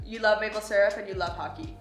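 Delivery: ordinary talk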